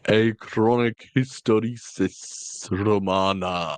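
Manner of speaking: slow, drawn-out theatrical impression; Tom Baker. Podcast host riffing on back pain, in a hammed-up Tom Baker voice. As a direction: stretch it out like a hammy actor. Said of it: "my Baker impression gets worse".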